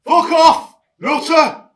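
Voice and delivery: very loud, high angry yell; furious shout. Acted. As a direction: scream them away at full force. Angry retort to a caller.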